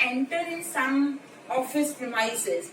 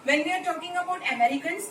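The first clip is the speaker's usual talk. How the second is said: normal talking voice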